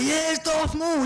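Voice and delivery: high, slow thrilled cry; excited. Retold or imitated. Actor watching with his kids, excited high cheer. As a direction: cheer it like a delighted fan. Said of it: "I get excited when I'm like".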